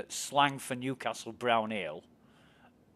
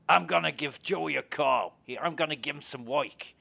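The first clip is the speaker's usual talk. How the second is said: hammed-up New York mafioso giving orders; mock New York mobster accent; 3 semitones higher than usual. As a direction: play a New York gangster sending out the hitman. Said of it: "All that kind of shit".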